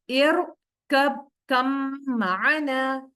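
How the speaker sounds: slow, drawn-out tajweed recitation; Quran recitation. Shown as a demonstration. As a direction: recite each syllable slowly with heavy r. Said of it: "तो अब मैं इसको पढ़ूंगी". (धीमी तिलावत)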